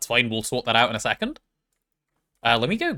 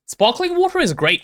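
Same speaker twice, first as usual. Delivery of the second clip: excited gush; 14 semitones higher, 3 dB louder than usual. Real, not acted. Streamer gushing over sparkling water.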